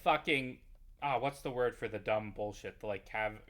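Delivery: ordinary delivery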